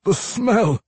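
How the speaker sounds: high, loud, anguished dwarf; loud high outburst of craving; tormented dwarf vampire. A narrator's reading. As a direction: blurt it out in torment.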